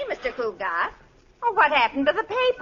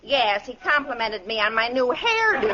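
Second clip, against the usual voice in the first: ordinary talk, nothing marked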